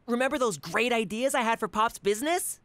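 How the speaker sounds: ordinary talk, nothing marked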